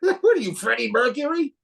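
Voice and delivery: a joking awed comparison; amazed. Retold or imitated.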